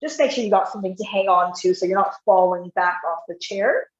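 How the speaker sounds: ordinary talk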